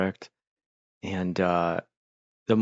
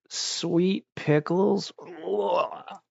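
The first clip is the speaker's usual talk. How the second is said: much higher, slowly drawn-out question then an ugh; disgusted surprise; 10 semitones higher, much slower than usual. Retold or imitated. Eater discovering the pickles are sweet, drawn-out horrified question. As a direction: stretch the words in horrified disbelief. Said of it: "you're like".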